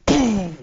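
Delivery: loud, high mouth boom; booming cannon shot; explosion boom. Acted. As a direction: blast it out like a distant cannon. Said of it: "this thing just goes".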